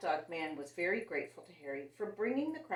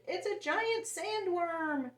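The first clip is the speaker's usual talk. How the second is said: high, slow frightened shriek; 11 semitones higher, 5 dB louder, much slower than usual. A narrator's reading. Another beachgoer shrieking in fright, high and slow. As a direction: cry out in alarm, stretched out. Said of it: "shrieked another".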